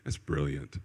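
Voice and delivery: imitated laid-back accent, very low and hushed; Graham Cook. Prophet unmoved while someone cries, laid-back imitation. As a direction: barely voice it, deep and chilled. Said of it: "He's got this, this accent".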